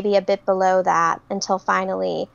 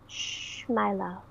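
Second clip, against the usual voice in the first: hushed, much softer, almost breathed; 11 dB quieter, slower than usual. Acted. Vampire soothing her entranced prey, soft hush. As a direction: hush him tenderly, barely voiced. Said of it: "softly say to him in her, uh, northern Italian accent".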